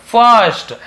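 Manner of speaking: "फर्स्ट" drawn out with an "uh" vowel; slow model reading. Shown as a demonstration. Teacher showing how it is read, slow "फर्स्ट" with "uh". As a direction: stretch out "फर्स्ट", furst, slowly. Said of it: "ऐसे".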